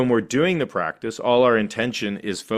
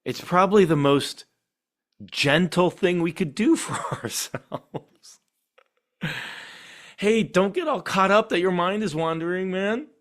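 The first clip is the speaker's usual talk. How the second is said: tender; 10 semitones higher than usual. Acted. Teacher voicing kind self-talk to a wandering mind. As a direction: coax yourself gently like a friend.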